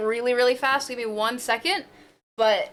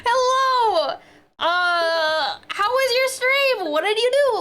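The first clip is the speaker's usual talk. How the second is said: high, louder, slow cheerful greeting; excited hello; 8 semitones higher, 3 dB louder, much slower than usual. Real, not acted. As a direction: beam at the raider with questions. Greeting the raider.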